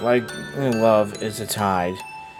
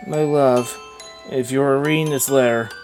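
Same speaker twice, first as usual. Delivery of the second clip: tender letter reading; 3 semitones lower, 6 dB quieter than usual. Acted. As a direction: read a dead wife's letter gently. Reads the dead wife's letter.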